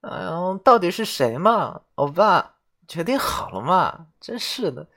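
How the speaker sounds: cutesy high-pitched wheedling; coy pleading. Acted. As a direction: coax them in a high pouty voice. Li You coaxing two rogues coyly.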